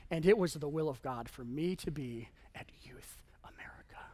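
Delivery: a sudden whisper, as if sharing a holy secret; hushed aside. Acted. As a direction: whisper it with mock reverence. Mock solemn aside about God's will.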